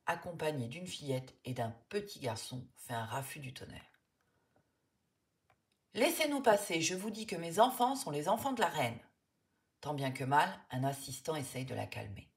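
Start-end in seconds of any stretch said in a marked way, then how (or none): 5.9-9.0 yelling. A narrator's reading. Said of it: "Madame, ne hurlez pas".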